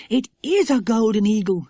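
high, excited recognition; amazed child. Acted. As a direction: marvel at the sight. Lucy Ann marvels at eagle.

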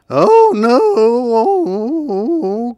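high, loud drawn-out wail; turkey's dismayed cry. A narrator's reading. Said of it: "cried the turkey".

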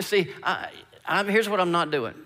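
ordinary talk

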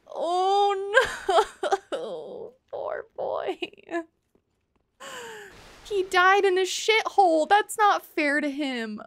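hammed-up wail, loud then fading; mock sobbing. Player mourning a character's undignified death, mock sobbing fading out. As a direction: play up the grief, trailing into weak whys. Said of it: "I'm sobbing hysterically".

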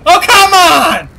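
loud, drawn-out groan of frustration; frustrated shout. Real, not acted. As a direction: yell it at the game in disbelief. Team devastated, frustrated.

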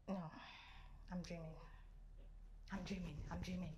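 very soft, low murmur; dazed disbelief. Acted. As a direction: murmur it to yourself as if asleep. Dazed, thinks it is a dream.

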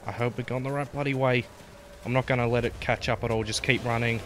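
loud, fast panicked urging; breathless frantic self-talk; being chased. Real, not acted. Running from monster.

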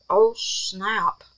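ordinary talk

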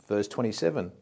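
normal talking voice

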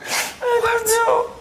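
slow, sobbing helplessness; tearful, drawn-out wail. Acted. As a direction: draw the words out through sobs. Bride crying over cancelled wedding.

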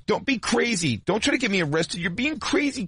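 high, insistent mock scolding; high mock-scolding girlfriend voice; bossy girlfriend. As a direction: play her snapping at him. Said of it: "really trying to do the".

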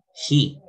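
"he" with a Spanish jota at the start. Shown as a demonstration. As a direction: say "he" slowly with an audible rough H. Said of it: "como si fuera una J la H".

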